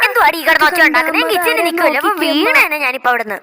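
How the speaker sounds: loud fast angry telling off; mother snapping at shouting daughter; scolding mother. Acted. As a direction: scold her for the racket.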